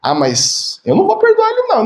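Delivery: high, whiny mock grudge; a hammed-up proud refusal; stubborn refusal. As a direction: imitate someone who won't forgive. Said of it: "nós pensamos assim".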